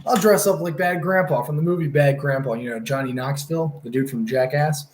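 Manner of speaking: ordinary delivery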